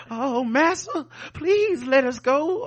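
high, slow pleading voice; mock pleading slave. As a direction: beg in a hammed-up whine.

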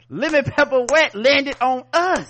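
slow, high, loud preacher line; mock Malcolm X speech. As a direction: declaim it like a rousing speech.

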